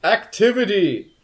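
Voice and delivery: high, loud, stately proclamation; booming cat voice. Acted. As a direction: boom it out like a cat addressing the whole court. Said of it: "is this booming sort of".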